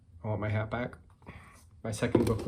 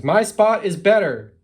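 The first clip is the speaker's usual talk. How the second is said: loud, high, stretched-out boast; storybook long-distance holler; shouting across a distance; 10 semitones higher, 14 dB louder, slower than usual. A narrator's reading. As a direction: yell it slowly across the gap. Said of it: "they have to shout now".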